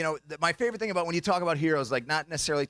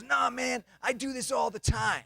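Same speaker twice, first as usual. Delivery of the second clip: higher-pitched cocky pal; imitated buddy; 7 semitones higher than usual. Buddy boasting about his scam, high mimicked voice. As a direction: brush off the worry in a raised voice.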